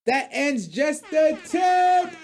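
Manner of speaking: high, drawn-out announcer shout; loud, slow hype call; segment sign-off shout. Real, not acted. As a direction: stretch it out big to close the segment. Announces end of show segment.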